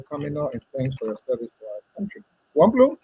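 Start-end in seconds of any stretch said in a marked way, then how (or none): 2.5-3.0 name called high and loud; calling out. Real, not acted. Caller grabbing the guest's attention, calling out loud. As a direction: call him to attention.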